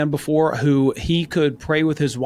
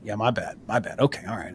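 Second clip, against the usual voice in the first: softer, sheepish "my bad"; humble admission; 4 semitones lower, 7 dB quieter than usual. Husband admitting to his wife he wasn't listening, soft and sheepish. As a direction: own it quietly and humbly. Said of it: "humble enough to go".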